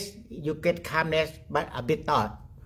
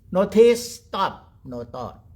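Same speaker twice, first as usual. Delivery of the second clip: ordinary talk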